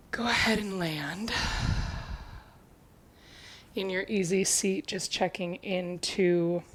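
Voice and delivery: slow, softening meditation voice with long pauses; guided meditation. Acted. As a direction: ease them gradually into stillness, slowly and quietly. Leads a seated check-in.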